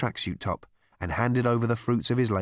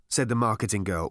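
usual speaking voice

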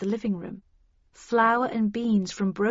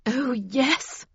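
higher-pitched, grimly certain; grim reply; 4 semitones higher than usual. A narrator's reading. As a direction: confirm it grimly. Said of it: "replied Havisham grimly".

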